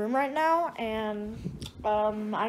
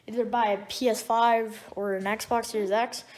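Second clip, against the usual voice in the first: normal talking voice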